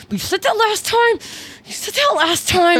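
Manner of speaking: high-pitched, worked-up fake scolding; mock accusation. To a girlfriend in a fake fight, mock accusation. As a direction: throw it at her, hammed-up and high. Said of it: "we fake fight".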